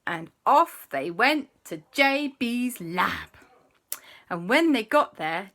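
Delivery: plain reading voice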